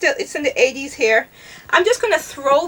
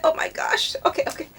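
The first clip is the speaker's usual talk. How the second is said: whispered oh my gosh; 6 semitones higher, 7 dB quieter, faster than usual. Real, not acted. Overwhelmed choosing a perfume.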